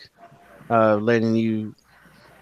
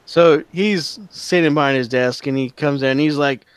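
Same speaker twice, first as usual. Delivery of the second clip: ordinary talk, nothing marked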